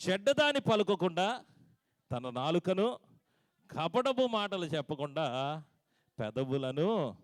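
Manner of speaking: loud, high verse, slowed in the middle; proclaimed verse. Acted. Pastor reading the verse to the church, loud proclamation. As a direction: declaim the scripture to the hall.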